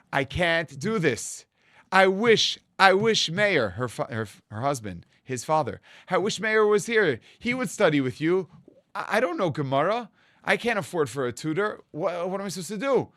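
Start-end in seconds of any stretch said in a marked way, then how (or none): 0.1-3.7 tearful plea. Retold or imitated. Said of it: "my mother burst out into tears". 6.1-13.1 higher, crying plea of a widowed mother; tearful mother. Retold or imitated. Mother bursts into tears over the test, higher, crying. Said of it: "And she started crying".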